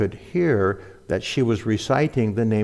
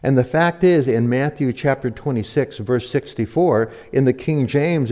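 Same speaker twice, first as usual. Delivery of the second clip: ordinary talk, nothing marked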